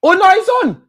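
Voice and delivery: high, loud joyful yelp; excited cry. Real, not acted. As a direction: cry out as the goal stands. VAR goal reprieved.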